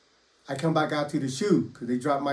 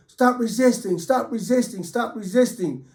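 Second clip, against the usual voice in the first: retold yelling; 7 semitones higher than usual. Officer yelling at the inmate he slammed, high mock shouting. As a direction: imitate the officer shouting the order over and over. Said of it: "And starts yelling".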